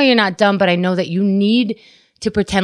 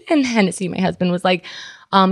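ordinary talk, nothing marked

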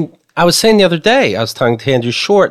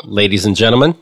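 announcer voice; 7 semitones lower than usual. Acted. Announcing the host.

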